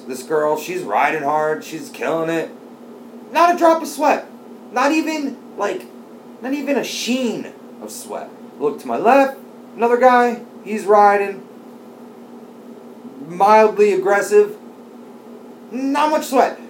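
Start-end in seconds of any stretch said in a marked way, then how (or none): 3.3-8.3 high, breathless disbelief; amazed. Real, not acted. Awed at dry classmate. 9.8-16.6 high and loud, incredulous; amazed. Real, not acted. Marveling at another rider.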